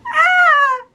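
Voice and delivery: loud high squeal of frustration; a real burst of exasperation; frustrated cry. Real, not acted. Reviewer reacting to the open ending with a loud high frustrated cry.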